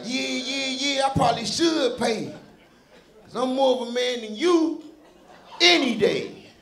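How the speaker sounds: high, boastful imitation of a young boy; mock a kid bragging in a high voice; little boy voice. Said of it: "a little thirteen-year-old little boy voice".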